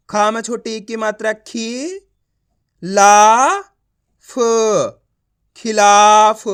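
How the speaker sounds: खी, ला, फ spaced out, ला loud and stretched, then a loud खिलाफ; teacher's emphatic sounding-out of खिलाफ; letter-by-letter reading. Shown as a demonstration. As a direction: project a long rising ला, then join as खिलाफ. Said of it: "आप इस तरीके से उच्चारण करोगे".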